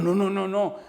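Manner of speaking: high, loud, alarmed no no no; the old man startled by the pastor's offer to kneel, protesting high and loud; frightened refusal. Retold or imitated. Alarmed protest. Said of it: "Y se asustó el hombre".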